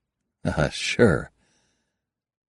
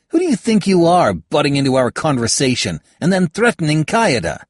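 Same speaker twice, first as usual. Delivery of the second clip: louder, higher indignant yell; angry shout; 9 semitones higher, 6 dB louder than usual. Acted. Halbert yells at the stranger for threatening Kayada, louder. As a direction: bellow at the intruder.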